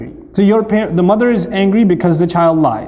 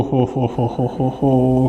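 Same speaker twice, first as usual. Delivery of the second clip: low, softer slow mock-ominous chant; 6 semitones lower, 5 dB quieter, slower than usual. Speaker mocking the parent's reaction to a confession. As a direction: ham up the coming doom.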